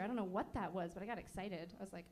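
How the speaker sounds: normal talking voice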